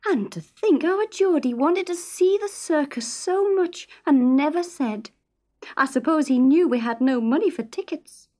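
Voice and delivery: a hint of a fretting mother; Isa's fretful voice. Isa musing aloud.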